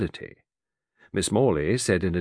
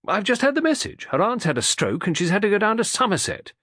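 fussy irritable man; 11 semitones higher than usual. A narrator's reading. Mr Morley reports the message.

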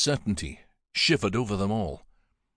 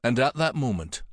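plain reading voice

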